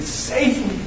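high but soft mock cry; retold scream. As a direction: voice a held-back plea for rescue. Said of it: "He screamed".

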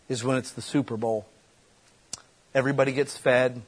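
ordinary talk, nothing marked

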